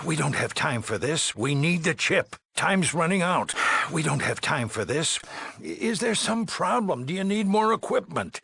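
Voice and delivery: high-pitched, agitated pressing; urgent and exasperated, voice pushed up; impatient urgency. Acted. Overseer presses the dweller as time runs out, agitated.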